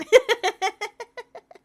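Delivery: a bright loud burst of laughter. Real, not acted. Laughing about her voice.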